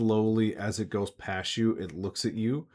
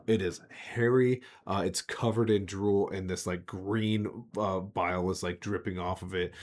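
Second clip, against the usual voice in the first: usual speaking voice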